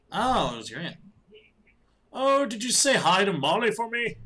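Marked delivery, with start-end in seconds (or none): 2.1-4.2 high, loud put-on old man; cheerful old dad, put on. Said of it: "old man voice".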